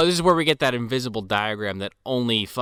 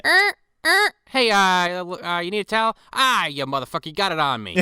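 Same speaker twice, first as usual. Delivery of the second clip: high, loud put-on duck voice; squeaky duck attendant; 8 semitones higher, 5 dB louder than usual. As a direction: offer towels like Howard. Howard at bathhouse.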